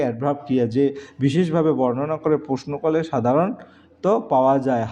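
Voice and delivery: ordinary talk, nothing marked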